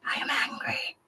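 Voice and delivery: "I am angry" whispered, no voice at all; whispered confession. Real, not acted. Admitting her anger to the group, whispered. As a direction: say it under your breath.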